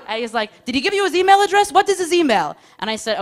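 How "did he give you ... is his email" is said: much higher, louder, fast frantic questions; shrill mock-parent panic; panicked mother hen. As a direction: grill her like a worried mom. Soldier interrogating like a mother.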